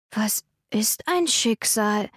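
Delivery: put-on slow, small child voice; curious child. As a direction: ask it like a wondering boy. Child asks.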